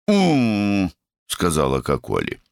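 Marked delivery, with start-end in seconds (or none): 0.1-0.9 сонное мычание. A narrator's reading. Сонная сестра мычит.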